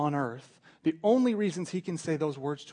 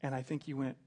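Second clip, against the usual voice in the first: ordinary talk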